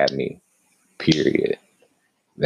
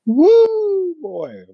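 high, loud woo of glee; excited whoop; 7 dB louder than usual. Real, not acted.

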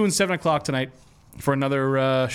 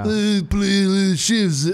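slow, hammed-up French accent; bad French accent; 4 semitones higher, 4 dB louder, much slower than usual. Cohost mocks Parisians with a slow bad French accent. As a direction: ham up a terrible French accent. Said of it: "my interpretation of what people in Paris sound like".

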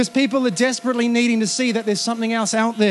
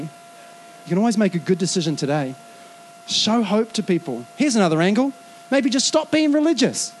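ordinary talk

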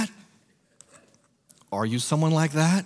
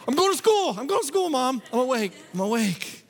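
much higher hurried protest; panicked teen; 10 semitones higher than usual. Retold or imitated. Teenage son to mom, startled and protesting. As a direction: jump up and promise mom you're going. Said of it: "I'd be like".

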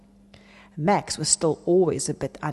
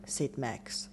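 ordinary delivery

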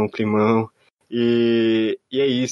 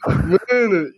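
drawn-out exasperated groan; 10 semitones higher, much slower than usual. Retold or imitated. Exasperated reaction, slow high.

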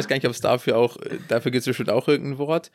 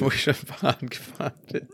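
laughing echo; 5 dB quieter, faster than usual. Retold or imitated.

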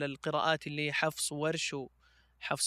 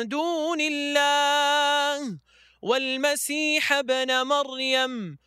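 slow, drawn-out melodic tajweed chant; Quran recitation; 6 semitones higher, 4 dB louder, much slower than usual. Acted. Reciter chanting Surah Taha for the interviewer, slow melodic recitation. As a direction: recite each verse slowly with long held notes, rising high.

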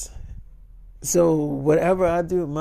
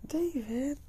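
Tia's voice; 9 semitones higher, 8 dB quieter than usual. Retold or imitated. Tia pleading on the phone.

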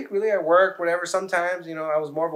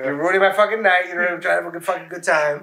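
raised, louder mock complaint; retold annoyed protest; 5 semitones higher, 5 dB louder than usual. Annoyed passenger to preaching driver, mock protest. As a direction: protest in mild, hammed-up annoyance.

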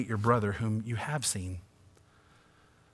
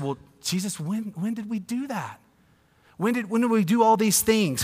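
much higher, bewildered when, when; puzzled sheep; 9 semitones higher than usual. Acted. Sheep asking Jesus when they ever did it, high and baffled. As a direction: stammer it out in amazement.

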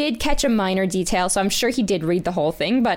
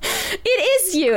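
sheepish realization; 11 semitones higher, much slower than usual. Retold or imitated. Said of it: "to be like".

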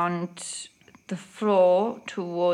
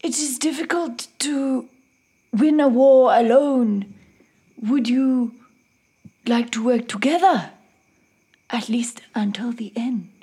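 lightly voiced warrior proposing an alliance; declaring in character; 3 semitones higher, 3 dB louder than usual. Warrior offers an alliance to fight together, measured declaration.

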